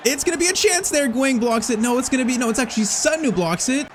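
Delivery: high-pitched, excited commentary; tense live commentary. Real, not acted. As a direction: call a last-gasp chance as it unfolds. Last-seconds chance in the match.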